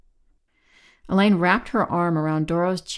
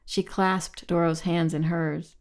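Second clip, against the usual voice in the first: ordinary delivery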